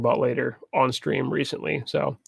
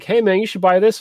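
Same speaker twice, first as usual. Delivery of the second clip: higher, upbeat sales pitch; pushy salesman voice; 7 semitones higher, 4 dB louder than usual. Retold or imitated. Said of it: "Steam was like".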